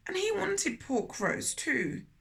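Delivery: ordinary delivery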